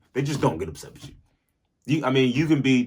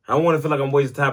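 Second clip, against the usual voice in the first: higher, louder, fast insistence; emphatic repeat; 6 semitones higher, 6 dB louder, faster than usual. Shown as a demonstration. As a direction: say it again with punch. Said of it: "repeated louder".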